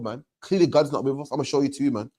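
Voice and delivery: ordinary talk, nothing marked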